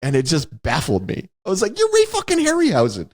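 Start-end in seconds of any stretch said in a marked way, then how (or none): 1.8-3.1 much higher, stunned exclamation; can't believe he doesn't know; disbelieving fan; incredulous. Retold or imitated. Incredulous retort to the old man.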